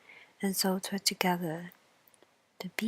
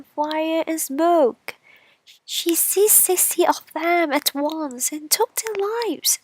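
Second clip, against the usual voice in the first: high, loud lament of the straw; high, loud grieving straw voice; straw character; 7 semitones higher, 8 dB louder than usual. Acted. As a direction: wail over lost brothers as the straw. Straw voiced in fairy tale.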